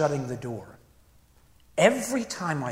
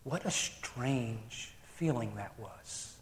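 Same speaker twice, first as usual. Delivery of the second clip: ordinary talk, nothing marked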